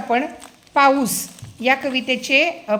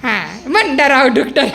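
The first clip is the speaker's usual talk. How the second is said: laughing while prompting the frog sound; slower than usual. Real, not acted. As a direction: giggle through the word डरावडूक. (हसत हसत सांगणे)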